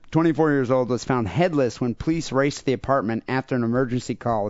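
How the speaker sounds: ordinary talk, nothing marked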